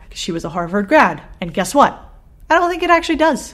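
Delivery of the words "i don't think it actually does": high, loud put-on Boston accent. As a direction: say it like a Bostonian, pitched up. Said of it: "Do you guys like my Boston accent?".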